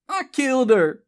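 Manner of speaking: high, loud outburst; blurted confession. A narrator's reading. Elizabeth confesses over Charlie's roar, blurting. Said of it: "she blurted".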